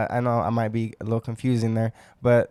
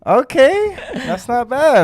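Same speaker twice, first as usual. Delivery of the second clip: impressed okay; 11 semitones higher, 4 dB louder, slower than usual. Real, not acted. Impressed by friend's phrase.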